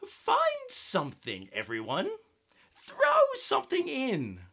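lightly voiced cheerful witch, high; witch with a grin. Witch invites friends to fill cauldron, cheerful call. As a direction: call them all to the cauldron. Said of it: "said with a grin".

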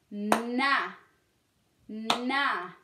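"Na" with a long held n before the vowel; stretched first sound. Shown as a demonstration. Teacher sounding out the second syllable, "Na" with lengthened n. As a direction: hum the n, then open firmly into "Na". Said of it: "Deuxième syllabe".

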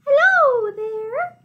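high, singsong little greeting; squeaky hello from a small mouse. A narrator's reading. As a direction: pitch it up like a tiny mouse. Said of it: "And Mouse says".

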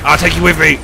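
ordinary delivery

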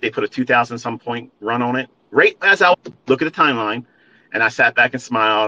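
normal talking voice

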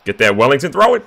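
building to a high, loud yell; frantic gameplay command, rising sharply; urgent shout. Real, not acted. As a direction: holler at your fielder to hurry. Urging fielder during live play.

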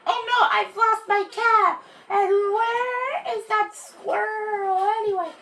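high, alarmed cry; dismayed. Acted. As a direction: fret out loud, lost and confused. Seed lost by squirrel.

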